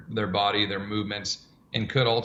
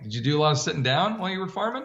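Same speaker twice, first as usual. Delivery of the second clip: teasing question; 7 semitones higher, much faster than usual. Retold or imitated. Teasing client about farming.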